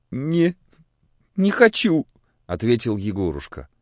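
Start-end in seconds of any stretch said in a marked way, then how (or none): 0.1-2.1 put-on high, plaintive child voice; sick boy. A narrator's reading. Sick boy refusing soup from the priest, plaintive. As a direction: refuse haltingly like a poorly little boy.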